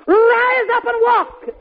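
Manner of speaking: high, slow commanding call; ringing command. Retold or imitated. Peter commands the lame man to walk, ringing command.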